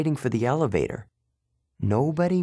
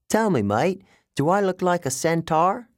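put-on higher, mannered British-like accent; strange British accent; 7 semitones higher than usual. A narrator's reading. Underworld guard challenges a boy's mistake, strange British accent. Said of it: "He had a strange accent, British maybe".

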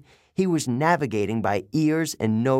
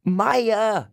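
yelling; 9 semitones higher, 3 dB louder than usual. A narrator's reading.

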